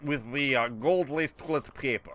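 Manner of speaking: slow, low mock foreign-villain accent; drawling, badly faked villain accent; put-on foreign accent. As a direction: gloat like a cartoon enemy in a fake foreign accent. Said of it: "I hate doing that voice".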